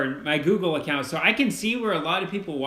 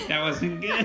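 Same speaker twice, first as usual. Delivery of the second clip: laughing. Real, not acted. Laughing at dad's accent attempt.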